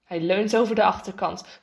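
ordinary delivery

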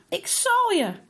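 high, loud angry shout; girl chasing her brother, shouting a loud, high threat; furious threat. Acted. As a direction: yell it as you chase him. Sister chasing brother in fury.

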